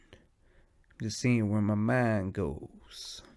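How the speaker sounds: slow, half-whispered stoner drift; drawn-out sleepy talk. Pretend. Sleepy podcaster talking with eyes closed, slow and hushed. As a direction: stretch every word out drowsily. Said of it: "What if I talk like that".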